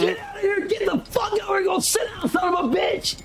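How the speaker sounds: high, shouted order to leave; high mock yell of a prison guard. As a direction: scream the inmate back to his cell. Acted guard yelling at inmate.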